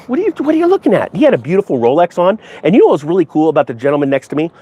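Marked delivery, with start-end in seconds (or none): 0.1-1.1 mock confrontational. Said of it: "It's like".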